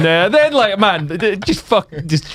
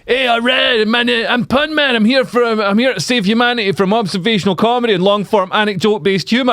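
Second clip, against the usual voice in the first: higher-pitched Pun Man stage announcement; superhero character; 4 semitones higher than usual. Retold or imitated. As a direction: declare it like a costumed comedy superhero. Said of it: "I go out and I'm like".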